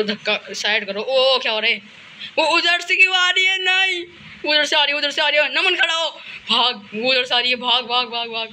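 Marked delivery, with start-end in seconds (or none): 2.4-6.1 high, alarmed cries; startled gamer panic; panicked. Real, not acted. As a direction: blurt it out in real panic. Enemy appears, panicked.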